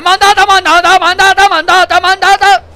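high, loud mock alarm chant of enemy soldiers; imitating game alarm. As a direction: parrot the game's alert call, hammed-up. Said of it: "han hecho el sonido ese de". (imitando la alarma del juego, agudo y fuerte)

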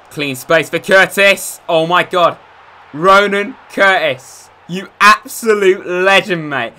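loud, slow celebrating yell; excited cheer. Real, not acted. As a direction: shout it out, thrilled by the goal. Goal celebration in game.

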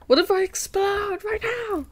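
very high, squeaky mock panic; high-pitched excitement. Streamer overwhelmed by nice comments, high playful squeal. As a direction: squeal it as if about to burst.

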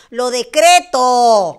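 slow, loud, emphatic decree; declaring it loudly to the universe; bold declaration. Real, not acted. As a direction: proclaim it like a vow. Declaring her dream boldly.

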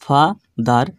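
ফা-দার in two separate beats, ফা lifted, then দার; split into two syllables. Shown as a demonstration. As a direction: break ফা-দার after ফা with a short pause. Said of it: "দুইবারে উচ্চারণ করা হলো".

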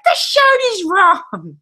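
a hint of a whiny child; mock complaint. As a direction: imitate the kid's fussy protest. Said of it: "Jack was like".

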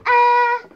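high, loud held "ah"; helium-voice "ah". Real, not acted. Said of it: "this is my Helium voice".

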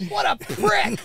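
high, loud mock outrage; hammed-up exasperation; indignant insult. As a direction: cry it out as a comic punchline. Acts out reaction to the doctor.

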